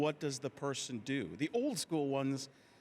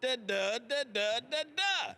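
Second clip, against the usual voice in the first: climbing rhythmic da-das rising much higher; Samuel L. Jackson cadence; 6 semitones higher, slower than usual. Shown as a demonstration. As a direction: build the beat up step by step. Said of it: "has a cadence".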